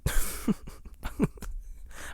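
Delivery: quiet, voiceless laugh; breathy chuckling. Real, not acted. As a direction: laugh softly to yourself at the memory. Laughing at mom being devastated.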